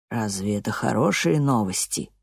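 low, soft and gentle. Acted. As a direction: answer softly, kindly. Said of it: "мягко ответил Тони".

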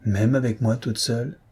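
ordinary delivery, nothing marked

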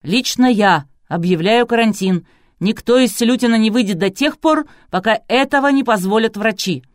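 loud, emphatic proclamation; barking. A narrator's reading. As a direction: lay down the law at full volume. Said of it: "рявкнул Клычко Жиляев". (рявкая, громко и властно)

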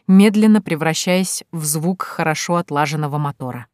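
ordinary delivery

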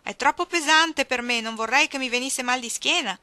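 shouting back. A narrator's reading. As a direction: keep yelling back across the yard. Old man shouting back from afar.

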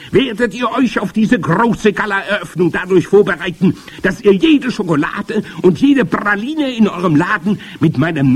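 high, loud witch declaiming; imperious high-pitched witch voice; grand witch. A narrator's reading. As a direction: proclaim the magic plan to your followers. Grand witch addressing the congress.

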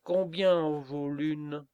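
loud, slow sneering hen turkey; mocking turkey. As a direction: jeer at the stork's legs. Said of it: "dit la dinde".

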